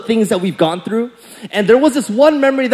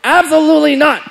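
high, loud, drawn-out outrage; indignant big-brother outburst; seeing red; 8 semitones higher, 4 dB louder, much slower than usual. Retold or imitated. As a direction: explode in mock-furious refusal. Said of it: "that day I saw red".

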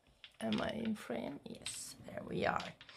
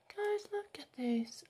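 high excited squeal; 12 semitones higher than usual. Real, not acted. Showing swatch to camera.

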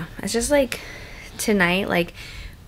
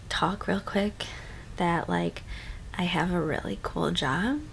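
whispering; 3 semitones lower, 7 dB quieter than usual. Real, not acted. Late-night quiet vlog chat.

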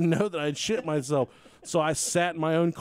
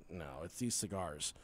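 much softer, low sheepish excuse; quiet denial; 10 semitones lower, 14 dB quieter than usual. Retold or imitated. Teller to wife, blaming the cigars, soft and sheepish. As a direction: mumble it like a guilty excuse.

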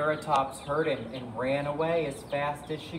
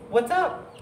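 high, perky question; curious hen; 9 semitones higher, 4 dB louder than usual. Acted. Edna the chicken asks the fleeing dinosaurs, high curious voice. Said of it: "she asked".

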